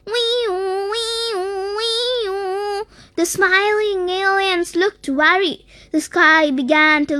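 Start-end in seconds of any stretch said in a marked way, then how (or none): 0.0-2.9 higher, sing-song siren wail; imitated alarm siren. A narrator's reading. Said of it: "loud alarms echoed across the planet".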